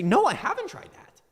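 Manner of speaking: high-pitched mock trader reply; mock naive reply. Voicing a trader taking the bait.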